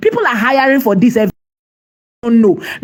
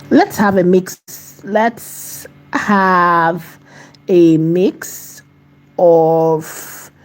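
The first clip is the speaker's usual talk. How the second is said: slow dictation; 2 semitones lower, much slower than usual. Real, not acted. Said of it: "I'm typing".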